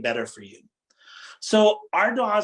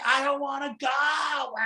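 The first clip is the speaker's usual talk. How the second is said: high, drawn-out whining wail, imitating a child; child's tantrum; 9 semitones higher than usual. Child crying to parents on leaving the park, whiny tantrum. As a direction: act out a kid's mock tantrum. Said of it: "They start doing this tantrum".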